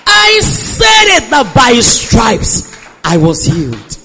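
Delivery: high, loud, very slow; triumphant shout. Retold or imitated. She tells her mother she declared healing, shouting slowly. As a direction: hammer each word out.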